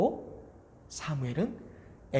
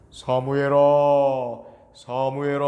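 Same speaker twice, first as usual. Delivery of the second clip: calling voice of God; 9 dB louder than usual. A narrator's reading. 하나님이 사무엘을 부름. (멀리서 부르는 하나님의 목소리)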